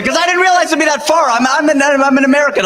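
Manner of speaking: high, self-mocking American outburst; self-mocking exclamation. Real, not acted. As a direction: blurt it out high like a clueless tourist. Mock dumb American self.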